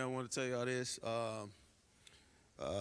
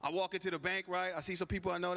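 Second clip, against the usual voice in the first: usual speaking voice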